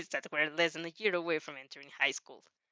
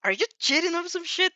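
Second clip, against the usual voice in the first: higher and louder accusing cry; 9 semitones higher, 6 dB louder than usual. Acted. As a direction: blurt it out in disbelief. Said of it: "He couldn't help but cry out".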